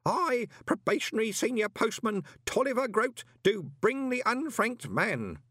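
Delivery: high, nervous ceremonial declaration; old postman solemnly declaring himself with nerves; shaking voice. A narrator's reading. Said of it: "his voice actually shook".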